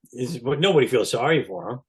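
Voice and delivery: ordinary talk, nothing marked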